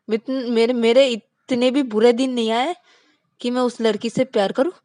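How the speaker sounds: loud, stammering, indignant protest; flustered denial. Acted. Vikas flustered denying love for Rani, loud and stammering. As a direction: deny it too hard, tripping on words.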